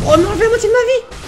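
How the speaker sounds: high panicked exclamation; alarmed cry. Real, not acted. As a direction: gasp it out in alarm. Losing half health in boss fight.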